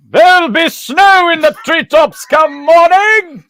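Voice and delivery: high, slow, hammed-up theatrical line; dramatic performance. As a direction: belt it like an over-the-top stage actor. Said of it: "the performance".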